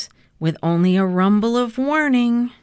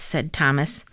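plain reading voice

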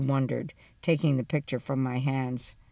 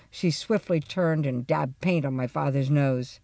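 plain reading voice

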